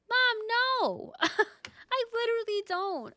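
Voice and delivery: high, loud mock cry of no; mock panicked refusal. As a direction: yelp it like a horrified daughter. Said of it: "It's a long story".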